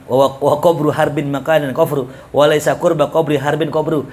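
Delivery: said fast. Shown as a demonstration. Teacher racing through the tongue twister to show the difficulty. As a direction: rattle the verse off quickly. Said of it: "Coba bilang cepet dah".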